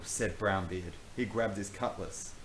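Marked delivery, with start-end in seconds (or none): none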